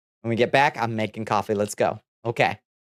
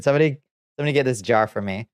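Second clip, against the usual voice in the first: normal talking voice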